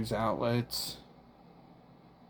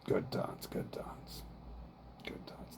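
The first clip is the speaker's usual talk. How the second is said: whispering; 9 dB quieter than usual. Real, not acted. Whispered self-soothing mantra.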